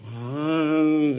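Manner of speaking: long drawn-out "ahh"; imitated blank hesitation. People stumped by the question, drawn-out hesitant "ahh". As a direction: hold a rising sound as if stumped. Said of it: "And people go".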